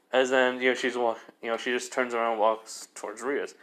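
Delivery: ordinary delivery, nothing marked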